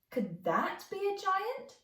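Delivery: put-on high wondering little boy; child voice. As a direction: ask the dog with boyish awe.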